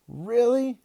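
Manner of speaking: high, loud rising question; thrilled disbelief. Acted. Kid hears of the interview, thrilled and amazed. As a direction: squeal the question in astonishment.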